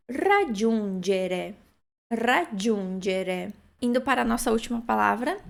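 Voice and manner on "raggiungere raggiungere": "Raggiungere" very slow, trilled r, djun, djere; syllabic Italian demo. Shown as a demonstration. Teacher recaps the verb, very slow careful pronunciation. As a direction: stretch each syllable of "Raggiungere". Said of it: "sílaba importante deste verbo".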